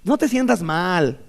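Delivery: slow, overly upbeat cheerleader friend; a lightly voiced pushy optimist friend; mock pep talk. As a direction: play the naive motivator, slowly. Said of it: "cuate bien motivado".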